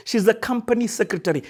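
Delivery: normal talking voice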